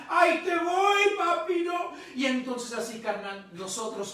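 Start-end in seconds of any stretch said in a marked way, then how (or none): 0.1-1.9 high, loud imitated kid's yell; child's shout. Boy leaping at his preaching papá, shouting in imitation. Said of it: "el niño grita".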